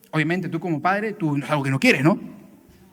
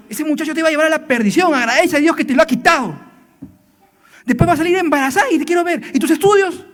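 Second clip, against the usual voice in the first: higher, louder scolding parent building to a shout; reproaching parent; 7 semitones higher, 4 dB louder than usual. Retold or imitated. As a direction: berate the daughter like an angry father. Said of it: "la usas para recriminar".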